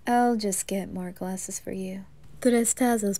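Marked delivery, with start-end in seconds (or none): 0.4-2.0 whispering. Acted. Quiet aside.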